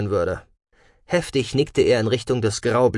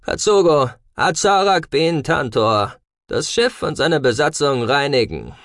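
raised, forceful command; commanding order; 5 semitones higher, 4 dB louder than usual. A narrator's reading. Arkonide orders robot to cleanse ship and crew, commanding. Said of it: "gab der Arkonide den Befehl".

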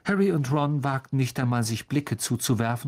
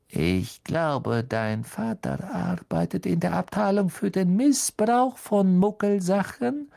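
spiteful; 7 semitones higher than usual. Acted. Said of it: "mit zunehmend gehässigem Lächeln".

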